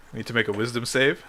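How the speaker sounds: normal talking voice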